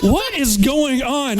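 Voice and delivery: high, slow, clueless marshal voice; dumb marshal. Retold or imitated. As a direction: play the marshal as baffled and slow.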